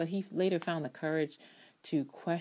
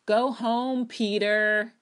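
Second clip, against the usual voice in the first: louder, higher brush-off; exasperated dismissal; 6 semitones higher, 7 dB louder, much slower than usual. Real, not acted. Fan telling Peter to go home, loud exasperation. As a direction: shoo him away loudly.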